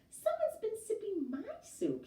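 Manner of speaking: high mock growl; a playful growl from Mama Bear. A narrator's reading. Mama Bear finding her soup slushed, high mock growl. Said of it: "she growled".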